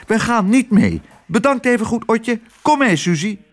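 hoge, piepende muizenstem. Acted. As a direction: een muisje dat avontuur wil. Lodewijk neemt afscheid. (hoge muizenstem van Lodewijk)

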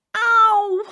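high, loud ow; pained groan. A narrator's reading. Jeroen leaving with a bump, loud pained groan. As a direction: groan loudly with pain. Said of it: "kreunde Jeroen".

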